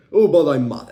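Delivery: loud mock angry girlfriend; imitating an upset girlfriend, loud; girlfriend mad. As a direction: throw it out like a sulky angry girlfriend. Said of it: "And then she was like".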